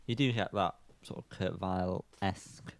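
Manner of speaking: ordinary talk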